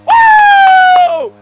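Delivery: very high screamed whoop; long, loud celebratory woo; piercingly high cheer; victory whoop. Real, not acted. Celebrates walk-off win.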